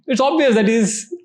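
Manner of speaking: normal talking voice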